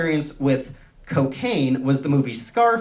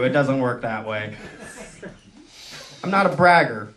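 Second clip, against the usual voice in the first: ordinary talk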